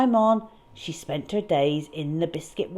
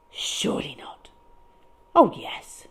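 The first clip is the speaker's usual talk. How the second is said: hushed whisper; whispered disbelief; 2 semitones higher than usual. A narrator's reading. Storyteller whispering as Fitzroy recognises the monster. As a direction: breathe it in disbelief.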